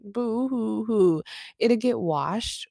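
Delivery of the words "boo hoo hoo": hammy high boo-hoo sob; mock sob, slow and drawn out; fake crying. Mock sobbing over dirty car.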